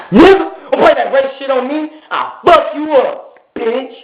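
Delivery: high-pitched angry warning; retold indignant threat. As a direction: act out squaring up to him. Retold threat after food offer.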